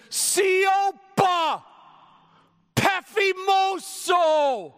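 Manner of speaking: high, loud, drawn-out command; Jesus shouting the storm down, slow and loud. Retold or imitated. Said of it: "you had to scream it like that".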